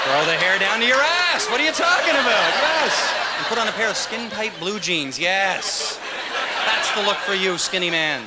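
high, loud, drawn-out cheering; mock enthusiasm. Comic egging on a skinny guy's rocker look, mock enthusiasm. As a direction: hype it up with big high yeses. Voicing delight at his own awful look.

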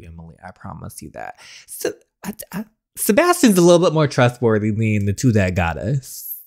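ordinary talk, nothing marked

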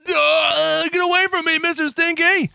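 high, loud hammed-up shriek; playful panicked shout. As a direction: scream to fend off a monster. Flees the monster.

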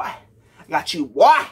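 put-on slow then loud on boy; drawn-out shout. Shown as a demonstration. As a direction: stretch it out, then belt boy. Repeats phrase, whispered then loud.

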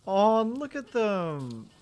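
cutesy cooing. Real, not acted. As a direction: coo over them like kittens. Cooing at working pals.

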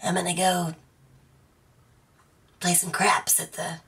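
soft, husky put-on smoker voice; whispery sexy smoker rasp. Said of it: "I sound like a sexy smoker".

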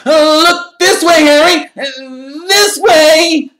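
very high, loud, drawn-out calling; squealing, eager fan's shout; shrill cry. A narrator's reading. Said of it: "he cried shrilly".

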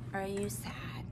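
whispered question. Acted. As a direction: breathe it softly. Asks toddler about feelings.

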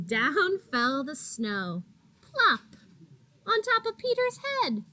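dramatic plop. A narrator's reading. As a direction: make the plop land with a burst. Snow falls on Peter dramatically.

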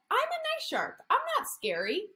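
high, sweet little shark voice; baby shark voice. Acted. Therapist voicing Baby Shark for preschoolers, high and sweet. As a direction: make the shark sound gentle and tiny. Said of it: "Baby shark said to himself".

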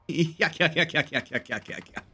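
repeated "yuk-yuk" fake chuckle, fading out; hammed-up goofy laugh. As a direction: laugh corny at your own pun, tailing off. Fake laugh after pun.

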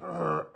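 pompous ahem; impressive throat-clearing. A narrator's reading. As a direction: clear the throat importantly before a speech. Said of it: "coughed in an impressive way".

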